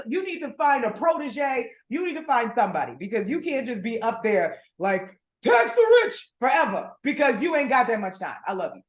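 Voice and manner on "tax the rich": high, loud slogan shout; imitated campaign-trail yell; mock rallying cry. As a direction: holler it like a politician on repeat. Mimics a politician's slogan.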